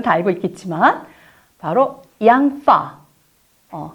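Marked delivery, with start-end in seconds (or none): 1.6-3.0 양파 stretched slowly with the 파 lip sound; stressed f sound. Shown as a demonstration. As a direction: lean on the 파 in 양파. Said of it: "파, 파 하는데".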